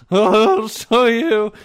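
put-on slow, loud, high drunken challenge; mock drunk yell. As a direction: slur it out like a drunk. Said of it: "All fucked up".

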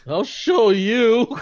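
slow, loud, high challenge through laughter; laughing slow shout of defiance; defiant yell, laughing. Retold or imitated. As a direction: bellow it as a dare. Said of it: "I'm like".

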